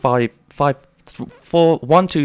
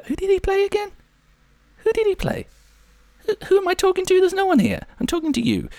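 high, put-on voice asking questions; mock spooky voice. Pretends to question an unseen presence, high put-on voice. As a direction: play a silly high voice talking to nobody.

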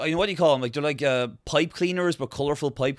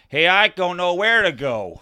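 slow, louder American soldier; mock American accent; 4 semitones higher, 5 dB louder than usual. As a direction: put on a higher, drawn-out American voice. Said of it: "he's like American's like".

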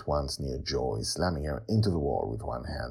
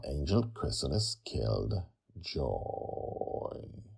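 soft, drawn-out musing; slow revelation; 2 semitones lower, 8 dB quieter, much slower than usual. Real, not acted. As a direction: let it trail out very slowly and quietly. Dwelling on the story's revelation.

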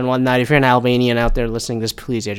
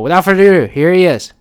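announcer intro; 7 semitones higher, 6 dB louder than usual. Acted. Introducing the guest on podcast.

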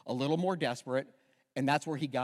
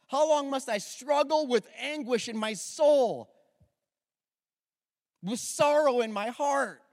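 much higher and louder anguished cry to God; David crying out; 7 semitones higher, 5 dB louder than usual. Acted. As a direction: cry out to God like a desperate psalmist. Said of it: "listen to David crying out".

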